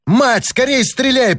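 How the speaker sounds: high, slowed, strained cry; desperate plea forced from the throat; strained croak. A narrator's reading. Boy urging his mother to shoot, strained croak. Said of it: "прохрипел он".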